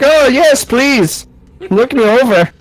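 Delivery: a tiny German figurine begging to be checked for magic; German-accented doll. Pretend. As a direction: squeak out the doll's plea in a German accent. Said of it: "I don't know why he's German".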